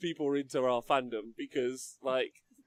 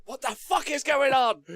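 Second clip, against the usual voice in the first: high, loud baffled outburst; bewildered yell at the chaos; confused Charlie; 10 semitones higher, 5 dB louder than usual. Pretend. Charlie yelling at the battle, high baffled outburst.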